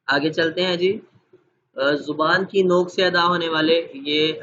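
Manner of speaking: normal talking voice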